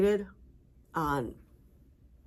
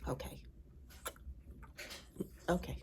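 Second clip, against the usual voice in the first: usual speaking voice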